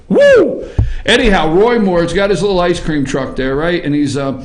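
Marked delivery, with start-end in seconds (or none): 0.1-0.7 high, loud whoop; gleeful hoot. Real, not acted. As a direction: whoop with delight. Host hoots after joke.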